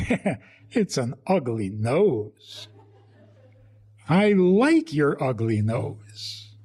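slow, chuckling through "ugly nose"; laughing, affectionate agreement. Retold or imitated. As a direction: tease lovingly while laughing. Parent agreeing fondly with kid.

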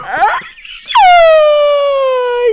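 long, very high, loud squeal; piercing drawn-out scream; excited scream. Real, not acted. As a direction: let out a sustained high shriek. Squeals over retold bathroom hookup.

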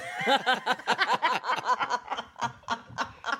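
long, high giggling fit; real laughter. Real, not acted. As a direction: crack up at your own joke. Laughing at own joke.

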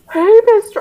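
put-on high, loud cracking teen voice; mock teenager greeting; scratchy puberty voice. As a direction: squeak like a boy whose voice is breaking. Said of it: "scratchy puberty voice".

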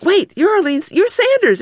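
high, rising disbelief on Arlene and Sanders; stunned realization. Retold or imitated. Lawyer realizing the woman is the lead plaintiff, astonished high voice. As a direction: say it as it dawns on you who she is.

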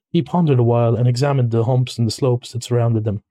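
ordinary delivery, nothing marked

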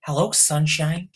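high, slow, sugary Wonka greeting; Willy Wonka voice. Retold or imitated. Host in a Willy Wonka costume greets co-host, high and slow. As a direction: draw out a creepy sweet hello. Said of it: "worst Willy Wonka outfit".

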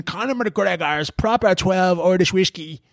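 Conor McGregor. Acted. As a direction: put on a piss-take Dublin accent. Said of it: "taking the piss out of Conor McGregor in a Dublin accent".

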